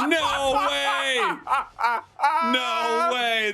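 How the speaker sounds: shouting no way while laughing; stunned disbelief. Real, not acted. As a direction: yell it in shock. Disbelief at the upset.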